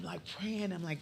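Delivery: normal talking voice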